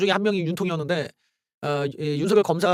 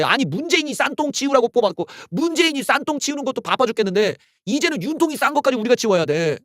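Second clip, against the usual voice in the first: usual speaking voice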